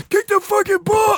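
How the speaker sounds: sideline dad yell. Pretend. Dad screaming at his son's game, full yell. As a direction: bellow at your kid from the sidelines. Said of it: "standing on the sidelines going".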